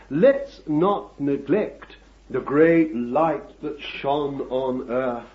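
normal talking voice

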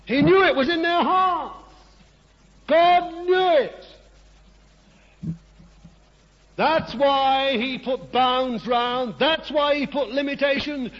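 preacher crying out God's knowing, high and ringing; full-force preaching. Real, not acted. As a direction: proclaim it to the congregation.

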